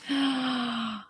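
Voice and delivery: soft sharp gasp of being moved; emotional gasp. Real, not acted. Streamer moved by viewers, a quiet emotional gasp. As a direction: catch your breath, touched.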